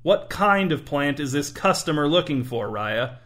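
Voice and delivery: ordinary delivery, nothing marked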